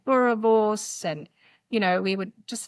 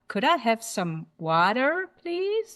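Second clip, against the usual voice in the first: American drawl. Retold or imitated. Diner repeating a water order for a confused waiter, American drawl. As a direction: imitate an American drawl for the request. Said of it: "I said it in the American drawl".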